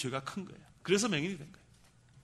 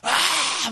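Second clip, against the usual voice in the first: high, loud open-throated "aah"; demonstrated shout to vent; full-out yell; 10 semitones higher, 7 dB louder than usual. Shown as a demonstration. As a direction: let out a big high scream. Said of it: "소리를 막 질르라고".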